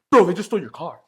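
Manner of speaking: urgent news. Retold or imitated. Neighbor at the window, urgently loud warning. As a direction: blurt it out urgently. Neighbor telling him car stolen.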